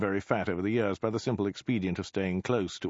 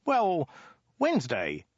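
exasperated; 9 semitones higher, slower than usual. Acted. Exasperated Ogdai proposes another day.

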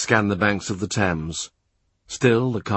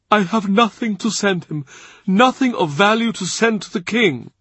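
put-on high-pitched lament of an overwhelmed old churchman; overwrought, high voice; distraught Cardinal; 10 semitones higher than usual. A narrator's reading. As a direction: lament it high, full of helpless gratitude. Weeping Cardinal overwhelmed by royal favour.

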